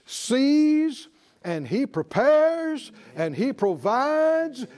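fervent preacher's cry; preaching at full force. Real, not acted. Preacher rising in praise.

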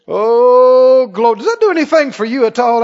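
usual speaking voice

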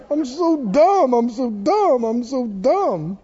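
hammed-up wailing self-reproach, drawn out toward the end; mock self-pity. Believer beating himself up alone in prayer, mock self-pitying wail. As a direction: moan it in exaggerated despair, building to a slow wail. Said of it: "believers talk like this".

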